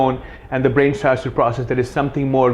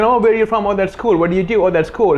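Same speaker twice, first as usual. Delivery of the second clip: higher-pitched, empty polite small talk; small-talk chatter; 7 semitones higher than usual. Shown as a demonstration. Coach imitating just having that conversation, high small-talk voice. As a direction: chirp through routine getting-to-know-you questions. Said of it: "instead of just having that conversation".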